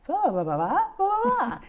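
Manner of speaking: high, loud "Ba, ba, ba" babble; baby babbling. Shown as a demonstration. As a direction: babble like an infant jargoning. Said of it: "that jargoning".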